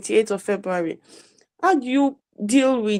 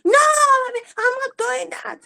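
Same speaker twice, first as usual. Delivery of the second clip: high-pitched imitation of a screaming daughter; child's scream. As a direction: mimic a child shrieking her refusal. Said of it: "She screams".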